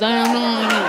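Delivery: dopey buck-toothed husband mumble; buck teeth impression. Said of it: "you have buck teeth and you talk like this".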